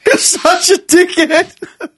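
high slow laughing jab; laughing insult. Real, not acted. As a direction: drag it out laughing.

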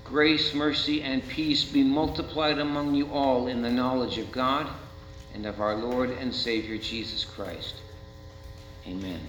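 low, soft blessing over the people; benediction. Acted. Pastor blessing the congregation, softly. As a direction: pronounce the blessing gently.

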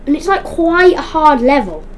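usual speaking voice

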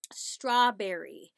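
"Strawberry" drawn out slowly and loudly; "Strawberry" stretched out, clearly formed; careful enunciation. Shown as a demonstration. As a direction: model "Strawberry" for a toddler, slow and exaggerated. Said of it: "see the way my mouth forms the words".

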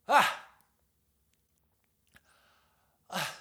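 high, loud groans of back pain; hammed-up aching back yelps; pained cries. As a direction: cry out as your back locks. Back pain while rocking.

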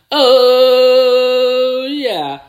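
high, loud catchphrase cry; triumphant shout; excited whoop. Real, not acted. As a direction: belt out the celebration. Signature celebration.

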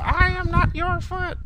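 high, slow imitation voice; drawn-out high character impression; put-on high voice. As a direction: quote it slowly in a squeaky-high put-on voice. Quoting a remembered odd line.

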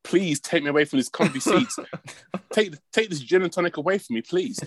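high-pitched, hammed-up begging; mock pleading. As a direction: play the reluctant victim, pleading high. Mock plea about luxury hospitality.

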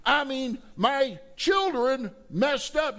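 high, loud voicing of a dismayed father; upset father King David. Preacher voicing King David upset over his children, high and loud. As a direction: cry it out as the shocked king.

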